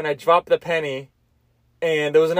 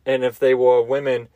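ordinary talk, nothing marked

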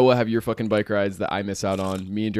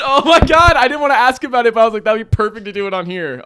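excited; 13 semitones higher, 11 dB louder than usual. Real, not acted. Gets birthday present mid-podcast.

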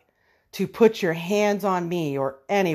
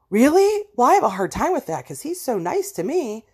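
dismissive coworkers; 7 semitones higher than usual. Retold or imitated. Coworkers doubting her about the bully, mocking. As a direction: brush it off in a high mock voice. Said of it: "they would say things like".

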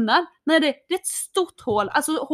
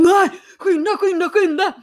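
urgent hurry; 5 semitones higher, 4 dB louder than usual. Retold or imitated. Rushing him from the parking warden.

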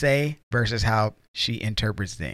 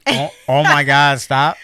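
a loud exasperated oh my God stop; loud mock yell; 8 dB louder than usual. Husband replaying his yell at his wife. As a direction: bark it out, fed up. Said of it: "it was a very loud".

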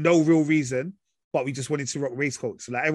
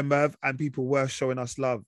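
ordinary talk, nothing marked

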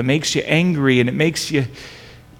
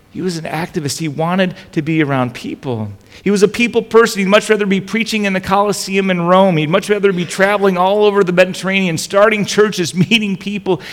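animated preaching; 6 semitones higher, 5 dB louder than usual. Acted. Preaching about Paul's zeal.

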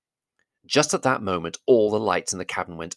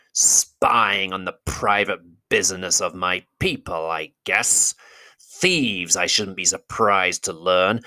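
slow, menacing, half-whispered accusation; great goblin voice. Acted. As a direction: whisper the threats like a goblin king.

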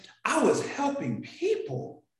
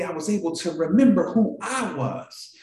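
ordinary talk

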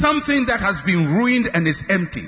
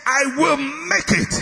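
higher, slow and firm, every word planted; forceful declaration; 7 semitones higher, slower than usual. Shown as a demonstration. Preacher shows the congregation how to say it, forceful declaration. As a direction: declare it like you mean it. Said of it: "I say, say".

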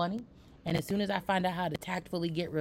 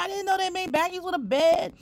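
surprised; 11 semitones higher, 5 dB louder than usual. Retold or imitated. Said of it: "And I was like".

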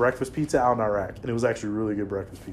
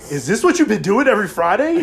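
mock outrage; 10 semitones higher, 7 dB louder than usual. Friend outraged at the beach.

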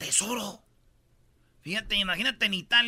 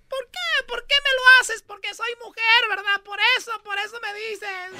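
much higher, whiny complaint; very high indignant mock voice; mock Serena; 14 semitones higher, 5 dB louder than usual. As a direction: whine like an outraged player.

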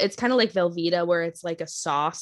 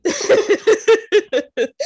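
laughing; 12 semitones higher, 10 dB louder than usual. Real, not acted. Laughing about the flying shell.

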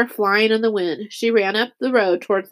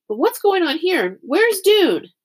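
urgent questioning; 9 semitones higher, slower than usual. A narrator's reading. Returned girl asks after her friend.

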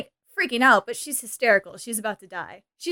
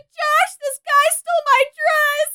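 much higher, whiny fake-distress voice; mock hysterical Cher; 13 semitones higher, slower than usual. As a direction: ham up a helpless damsel's panic. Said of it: "She's putting on a hysterical".

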